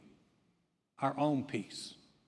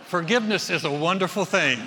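laughing through the line, louder; 2 semitones higher, 8 dB louder than usual. Real, not acted. Preacher to congregation, laughing after the joke. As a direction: still chuckling as he speaks.

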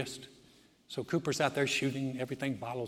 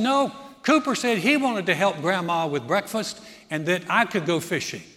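higher, loud cheeky announcement; pointed objection; 5 semitones higher, 10 dB louder than usual. Retold or imitated. Sister volunteering her brother to grandpa, cheeky. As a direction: speak up loudly for all to hear. Sister speaks up over breakfast.

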